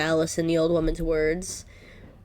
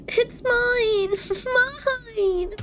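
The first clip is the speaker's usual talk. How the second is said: put-on high, whiny little girl wailing; bratty child clinging to her candy; pitched-up tantrum voice with sobbing noises; Maria's voice; much slower than usual. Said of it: "doing Maria's voice".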